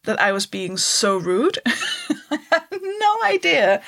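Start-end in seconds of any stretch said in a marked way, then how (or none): 1.6-3.8 high, laughing disbelief. Real, not acted. Comic laughing at her own rudeness in Britain. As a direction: laugh through the words. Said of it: "laughing at herself".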